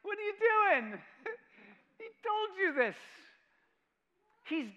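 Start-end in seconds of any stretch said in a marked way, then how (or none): 0.0-3.0 high, loud incredulous angels; mock the angels' disbelief, high and loud. Preacher voicing angels at the tomb, high incredulous shout. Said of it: "the angels are like".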